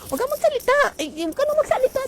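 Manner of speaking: high-pitched mock scold, fast at the end. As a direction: play a fussy nagging mare hushing her friend.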